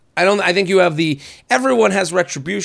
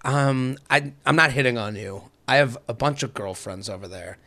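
low, soft stranger at the bar; imitating a man speaking low and confidentially; low-key retold approach; 8 semitones lower, 6 dB quieter than usual. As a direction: lean in quietly with a discreet pitch.

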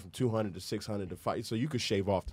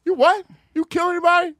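high, loud mock interrogation; mom grilling her son; 8 dB louder than usual. North Philly mom questioning her son, high and loud.

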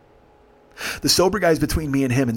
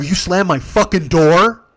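slow, loud, raised challenge; retold angry confrontation; 5 semitones higher, 8 dB louder, slower than usual. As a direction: demand an answer, stretching out the question. Teller confronts passengers, retold.

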